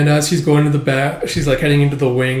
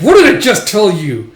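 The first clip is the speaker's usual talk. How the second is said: loud, higher-pitched angry telling-off; irate dad, voice raised hard; scolding father; 6 semitones higher, 7 dB louder than usual. Acted. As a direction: scold your child in exasperation.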